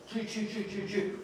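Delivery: quick, quiet "choo" tapping noises; light vocal hammer taps; imitated hammering. Building a fence with Jimmy, imitated hammer taps. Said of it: "And I go".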